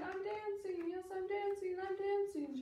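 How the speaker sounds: high, half-sung cry of joy; joyful cry. A narrator's reading. Gerald crying out as he finally dances, elated. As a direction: sing out your joy. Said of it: "Gerald cried".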